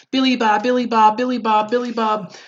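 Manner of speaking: high-pitched singsong mocking chant; taunting kid. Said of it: "the kid was like".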